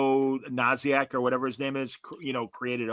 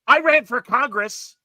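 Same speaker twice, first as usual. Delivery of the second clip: higher, louder, slow self-important claim; mock pompous boast; 11 semitones higher, 7 dB louder, slower than usual. Guy boasts of running for Congress, mock pompous slow. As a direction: puff up and pronounce it grandly.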